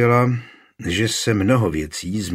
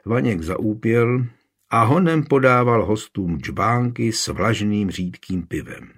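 ordinary delivery, nothing marked

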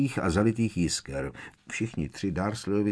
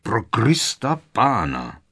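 loud, slow exasperated oath; roaring, drawn-out exclamation; thundering; 5 semitones higher, 5 dB louder, slower than usual. A narrator's reading. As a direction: boom it out with raised pitch. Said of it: "zaburácel strýc Vernon".

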